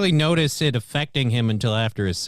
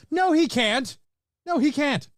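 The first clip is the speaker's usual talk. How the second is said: emphatic denial; 9 semitones higher than usual. Real, not acted. Emphatic rebuttal to quoted objection.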